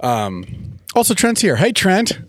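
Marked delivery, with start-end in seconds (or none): none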